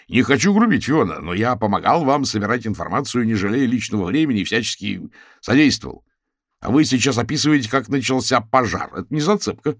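aggrieved public challenge; indignant objection. A narrator's reading. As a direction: protest before the whole hall. Frank challenges speaker at meeting.

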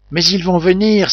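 wounded man's frightened outcry; alarmed cry. A narrator's reading. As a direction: blurt it out in fear of the convicts. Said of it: "s'écria Ayrton".

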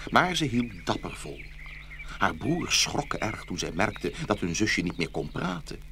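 ordinary delivery

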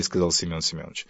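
ordinary delivery, nothing marked

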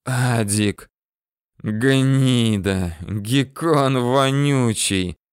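drawn-out venomous hiss. A narrator's reading. Dying chief curses his fleeing man, hissing. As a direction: hiss the insult slowly. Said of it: "шипит Ахмед".

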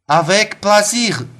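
a bright, slow, delighted French exclamation; exclaiming. A narrator's reading. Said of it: "воскликнула Румянцева". (радостно восклицая)

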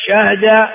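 شهد as shahda, no vowel on the ha; middle vowel dropped. Shown as a demonstration. Teacher listing four verb variants, شهد said shahda. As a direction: say شهد as shah-da. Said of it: "أربع لغات".

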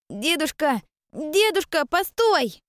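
put-on high, loud calling child; child shouting. A narrator's reading. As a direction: shout after him like a small boy. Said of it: "кричал босоногий мальчонка".

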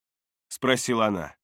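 usual speaking voice

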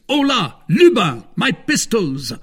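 high, loud summons to a servant; urgent call. A narrator's reading. Gentleman calls his lackey for pistols, urgent call. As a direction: call out sharply for weapons.

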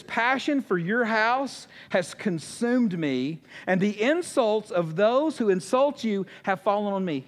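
very slow, drawn-out reading of the psalm; fervent verse reading. Acted. Preacher reads David's psalm to the church, slow and fervent. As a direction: lean into each word like a heartfelt prayer. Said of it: "David says".

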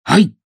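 a sudden high, loud yelp; sharp cry of pain. A narrator's reading. Frederick cries out when struck on the leg, sharp loud yelp. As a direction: yelp as a hard object hits your leg. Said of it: "вскрикнул Фредерик".

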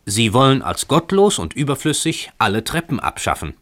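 high-pitched indignant report, mildly called; fruit seller calling out. A narrator's reading. Fruit seller warning His Excellency about the sect, mildly called out. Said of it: "rief ein Obsthöker".

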